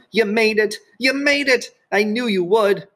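very high, jubilant yell. A narrator's reading. Marty yelling as Sheila reaches the ladder, jubilant yell. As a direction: yell it like a proud coach. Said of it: "Marty was yelling".

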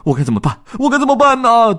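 rising into a high, loud wail; frantic. Acted. Dodds at the empty station, frantic and rising. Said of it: "他急得团团转".

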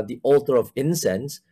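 usual speaking voice

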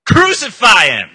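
high, drawn-out full yell; very loud crowd cry; angry mob shout. Acted. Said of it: "They shouted back".

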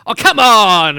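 loud exasperated yell at the football; frustrated shout at the telly. Retold or imitated. Son watching the World Cup, yelling at the players. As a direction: let it burst out at the screen. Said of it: "and he goes".

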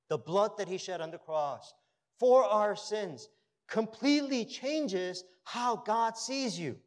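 a pastor pressing the point home from the pulpit; loud, slowing sermon delivery on the key lines; fervent preaching. Acted. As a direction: preach it with rising force and long pauses. Preaching, loud and slow in places.

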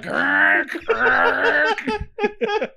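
high breathy grinding noise mock; laughing grinding gears. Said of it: "It's like".

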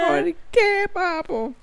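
tiny high-pitched comic whine; mock pleading. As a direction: plead pitifully in falsetto. Repeats the dismayed cry, higher.

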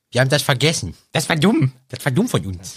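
lightly acted voice message; quoting a friend's exclaimed message; Michel. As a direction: play back a friend's self-reproach. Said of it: "wie der Michel mir eine Sprachnachricht schickte".

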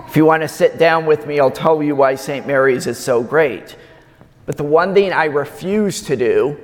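normal talking voice

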